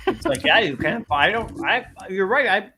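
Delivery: talking around ice cream; eating ice cream. Real, not acted. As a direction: mouth full of cold ice cream. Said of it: "sorry, I'm eating ice cream".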